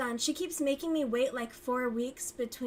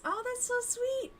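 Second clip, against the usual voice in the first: squeaky high, touched; high delighted; 13 semitones higher than usual. Real, not acted. To Libby about cookies, a squeaky delighted coo. Reacting to kind offer.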